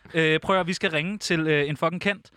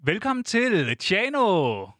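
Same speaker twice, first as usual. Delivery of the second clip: slow, drawn-out jingle announcer, ending on a loud high shout; radio jingle voice; 10 semitones higher, 5 dB louder, slower than usual. Pretend. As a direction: announce the station like a homemade jingle, stretching it out, then yell the last word. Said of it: "Okay, den kommer her".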